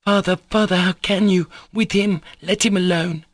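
put-on very high, impassioned child's plea; piercing, distressed little-boy protest; boy crying out. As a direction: call out to father in a high young voice. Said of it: "cried the boy".

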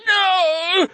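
high-pitched, drawn-out desperate "no"; ragged cry. A narrator's reading. Fleeing man fallen as beasts close in, ragged cry. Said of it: "A ragged ... ripped its way from his throat".

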